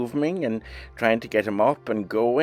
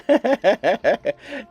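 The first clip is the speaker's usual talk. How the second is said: delighted high laugh; 12 semitones higher, 4 dB louder than usual. Real, not acted. Laughs at the goat's mischief.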